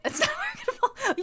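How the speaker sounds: words broken by a high laugh. Real, not acted. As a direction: laugh through the last word. Laughing through repeated line.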